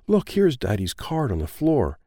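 plain reading voice

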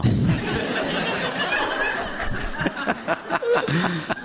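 long, high burst of laughter; hearty high laugh, tickled; real laughter. Real, not acted. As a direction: laugh freely at your own joke. Joking about starting to drink.

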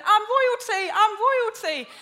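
high, loud, laughing cry of delight; overjoyed. Retold or imitated. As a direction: shout it like you just found out you're royal. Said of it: "he runs back to the house going".

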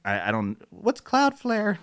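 high, slow puzzled Siri; imitating Siri. Mocking Siri who misheard him, high and slow. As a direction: play a confused voice assistant. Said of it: "Siri's like".

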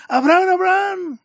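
high ringing call of the name; angel's call. Retold or imitated. Preacher voicing the angel calling down to Abraham, high and ringing. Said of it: "le gritó".

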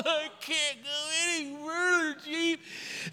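high, soft mock sobbing whine; crying recruit. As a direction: blubber it pitifully to the chief. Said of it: "another guy crying too".

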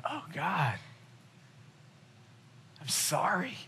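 soft, near-breathless apology to God; hushed prayer. Retold or imitated. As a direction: murmur it like a private confession. Retelling his own repentant prayer.

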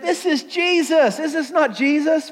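high-pitched, drawn-out disbelief; acted high incredulity; incredulous crowd. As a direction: question it like a scandalized crowd.

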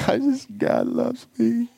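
high, drawn-out tearful squeak; mock sobbing. Student to classmate who asks if he's okay, mock sobbing.

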